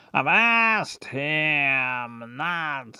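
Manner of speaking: very slow, drawn-out delivery; slow-talking character. Shown as a demonstration. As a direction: stretch each word right out. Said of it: "one speaks much slower".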